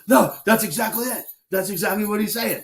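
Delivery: high-pitched, emphatic quote; excited retold agreement. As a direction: imitate someone exclaiming that you've nailed it. Retelling student's excited agreement with him.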